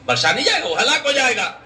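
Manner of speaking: ringing repeated verdict; emphatic warning. Real, not acted. Preacher repeats the warning with force.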